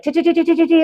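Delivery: high, quick "tee, tee, tee" like a reel flickering past; rapid movie reel noise. Retold or imitated. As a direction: mimic a film reel whirring through memories. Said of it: "movie reel very quickly".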